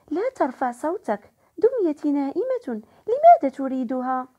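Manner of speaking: ordinary delivery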